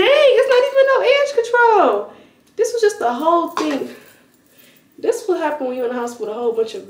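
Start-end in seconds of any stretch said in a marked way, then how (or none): 0.0-3.9 exasperated surprise. Real, not acted. Vlogger finding her edge control jar empty, exasperated high outcry.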